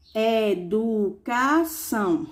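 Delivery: Educação very slow, e-du-ca-ção each beat apart; split into syllables. Shown as a demonstration. Teacher showing pupils a four-syllable word, slowly syllabified. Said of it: "polissílabas".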